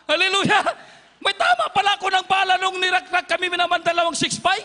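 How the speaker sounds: excited shout. Real, not acted. Preacher excited at X-ray revelation.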